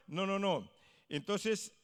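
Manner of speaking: normal talking voice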